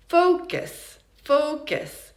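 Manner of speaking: "Focus" as FOH-kis, high stressed first syllable; schwa near ih. Shown as a demonstration. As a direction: reduce the end of "Focus" to a light ih. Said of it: "it sounds even more like".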